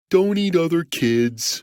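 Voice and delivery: put-on slightly high, subdued giant kid; sheepish. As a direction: admit it like a scolded child. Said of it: "said the giant sheepishly".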